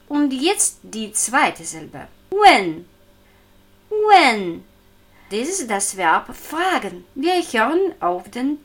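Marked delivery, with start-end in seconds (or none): 2.3-4.6 "Wen" loud, falling steeply; falling fourth tone. Shown as a demonstration. Teacher introducing the second syllable, "Wen" in falling fourth tone. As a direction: start "Wen" strong and drop the pitch. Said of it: "Fallender vierter Ton".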